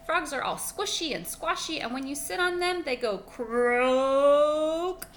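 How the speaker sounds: high, loud dog voice, slowing on croak; chirpy dog relishing the squish; cheerful dog. Acted. Dog explaining why he likes frogs, high and playful.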